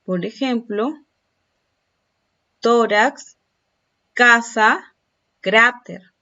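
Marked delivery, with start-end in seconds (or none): 2.6-6.1 tórax, casa, cráter called out loud, first syllables punched; stress on penultimate syllable. Shown as a demonstration. Teacher giving examples of graves, first syllable of tórax, casa, cráter stressed. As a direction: hit TÓ-rax, CA-sa, CRÁ-ter, one at a time. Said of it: "intensidad de voz en la penúltima sílaba".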